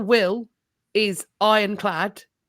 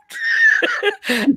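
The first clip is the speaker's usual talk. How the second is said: squealing high laugh; high burst of laughter; 5 dB louder than usual. Real, not acted. Laughing at the old knight.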